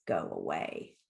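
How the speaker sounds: very low, softened grumble; deep, quiet dismissal; grumbling possum. A narrator's reading. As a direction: mumble it low as if playing dead. Possum grumbles while playing dead.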